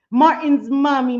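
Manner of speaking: usual speaking voice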